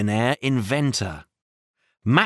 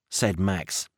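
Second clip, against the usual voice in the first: ordinary delivery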